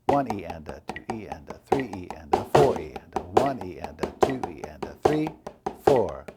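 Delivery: number hit hard, "E and a" falling away; accent on every beat. Shown as a demonstration. Drum teacher demonstrating random accents, numbers punched. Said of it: "Here's an accent on every beat".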